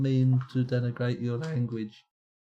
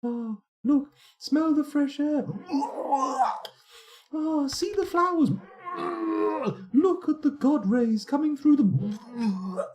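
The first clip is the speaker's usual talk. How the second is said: very high falsetto delight; hammed-up high sweetheart voice; imagined fortress dweller; 4 dB louder than usual. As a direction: squeal with mock rapture until it turns to retching. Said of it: "That's what it's like".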